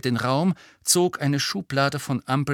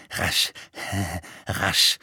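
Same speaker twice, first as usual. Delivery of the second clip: wheezy mutter; 4 semitones lower than usual. A narrator's reading. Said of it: "eine pfeifende Stimme ... murmeln".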